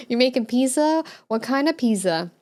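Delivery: normal talking voice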